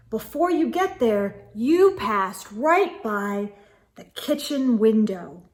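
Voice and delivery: usual speaking voice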